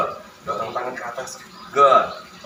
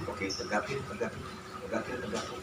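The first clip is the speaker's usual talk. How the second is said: quiet coaching; 15 dB quieter than usual. Real, not acted. Quiet cue to patient.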